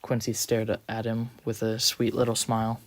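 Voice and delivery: usual speaking voice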